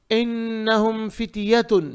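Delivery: slow recitation with a long humming n; tajweed recitation. Shown as a demonstration. Teacher models an easy verse, measured tajweed. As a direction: recite it evenly, holding the doubled n.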